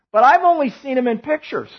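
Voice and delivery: usual speaking voice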